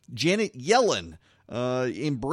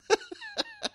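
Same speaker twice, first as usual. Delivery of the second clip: squealing laugh. Real, not acted. Laughing at the Johns ticket.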